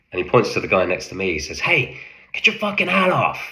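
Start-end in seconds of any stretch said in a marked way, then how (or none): 1.6-3.4 higher, barking American officer's order; American officer accent. Retold or imitated. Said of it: "he stayed in his American voice".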